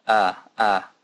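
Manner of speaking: ah like the a in fashion; ah vowel demo. Shown as a demonstration. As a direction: open ah as in fashion. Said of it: "like in fashion".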